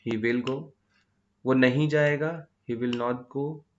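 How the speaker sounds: usual speaking voice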